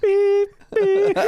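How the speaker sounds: high-pitched vocal beep-beep; imitated beeps. Pilot circling back past the target, high mock beeps. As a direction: squeak out two horn beeps.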